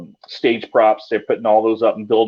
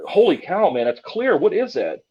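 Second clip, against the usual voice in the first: higher, stunned outburst; retold amazement; 8 semitones higher than usual. Reacting to the clear baby giggle, retold amazement. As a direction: sound stunned by what you just heard. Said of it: "I said".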